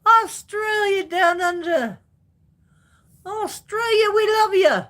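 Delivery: high, loud shout-out to Australia; playful cheer. Pretend. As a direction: holler it like a rousing mock anthem. Mock cheer for viewer's country.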